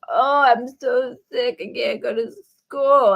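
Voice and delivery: whiny fake-sick kid. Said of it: "He'd be like".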